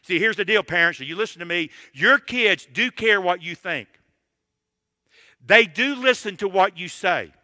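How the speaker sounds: usual speaking voice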